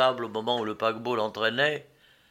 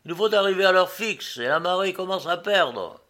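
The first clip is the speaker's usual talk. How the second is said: higher, hurried call to passengers; captain's exclamation; 6 semitones higher, 3 dB louder than usual. A narrator's reading. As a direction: insist on leaving, voice raised. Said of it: "s'écria le capitaine".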